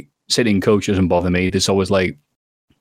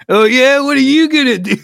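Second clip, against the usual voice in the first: loud, much higher mock-tough taunt; taunting challenge; 5 dB louder than usual. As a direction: square up to them, hammed-up. Mock taunt to fellow passenger.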